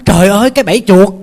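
frightened squeal of a mouse; panicked mouse cry. Acted. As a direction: blurt it out in alarm, as if you just spotted the trap. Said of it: "nó hết hồn hết hải".

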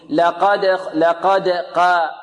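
mistaken thin, light ق in لقد; ترقيق القاف خطأً. Shown as a demonstration. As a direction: flatten the قا of لقد to a light open vowel. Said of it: "لا نقول". (ترقيق قاف لقد بدل تفخيمها)

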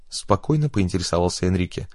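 usual speaking voice

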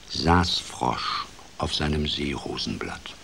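ordinary delivery

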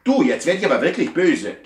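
angry scolding. Acted. Master scolds his cat Sebastian, loud and angry. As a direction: scold the runaway cat with rising anger.

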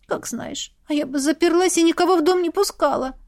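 put-on mildly disapproving girl muttering advice; grumbling. A narrator's reading. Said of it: "проворчала Илоша".

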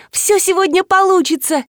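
high-pitched elated cry; ликование. A narrator's reading. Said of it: "заликовала Марина". (ликуя, высоким голосом)

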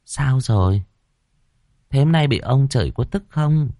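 lightly coquettish, smiling question; flirtatious. A narrator's reading. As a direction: tease him with a lilting question. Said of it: "lả lơi mỉm cười".

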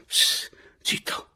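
whispered warning with a hissed psst; hushed, voiceless alert; urgent hushing. A narrator's reading. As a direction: shush them quickly in a whisper. Warns him as Adriana approaches.

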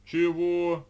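loud, high, outraged "what?"; indignant. A narrator's reading. Geranin accused of drinking half the bottle, indignant. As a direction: protest loudly at the accusation. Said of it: "возмутился было Геранин".

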